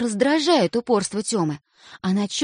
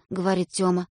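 plain reading voice

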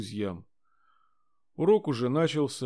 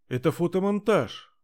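high, loud outraged denial; indignant; 7 semitones higher, 5 dB louder than usual. Acted. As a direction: protest the accusation hotly. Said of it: "натурально возмутилась девочка".

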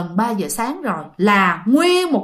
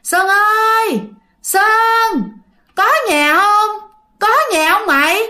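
high, far-off ghostly call; haunting call for Sơn, pitched high; eerie calling voice; 10 semitones higher, 3 dB louder, slower than usual. Retold or imitated. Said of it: "mẹ với lại bạn nghe vậy nè".

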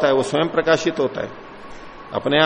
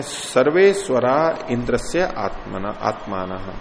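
slow Sanskrit recitation; 3 semitones lower, slower than usual. Acted. Sanskrit verse chanted slowly.

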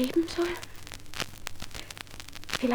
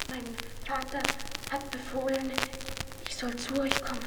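timid whisper; 10 dB quieter than usual. Acted. Shy girl before the king.

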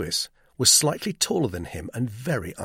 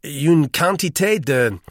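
loud, high, struggling French; faltering admission in laboured French; halting French; 6 semitones higher, 7 dB louder than usual. A narrator's reading. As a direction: grope for French words and run out. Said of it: "He could get no farther".

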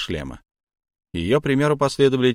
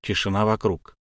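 usual speaking voice

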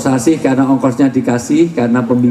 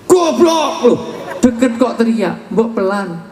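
mock angry shout; 8 semitones higher, slower than usual. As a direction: yell at someone standing right beside you. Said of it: "Maka orang marah deket tapi teriak". (bentakan marah yang dibuat-buat)

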